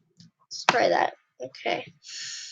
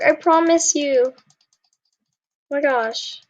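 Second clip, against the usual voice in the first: normal talking voice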